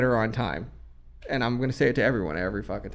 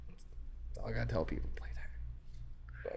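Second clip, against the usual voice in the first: slow, breathy stage whisper; 5 semitones lower, 19 dB quieter than usual. Acted. Producer sharing his one tip, in a slow whisper. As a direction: lean in and whisper the secret. Mock-secret advice to guitarists.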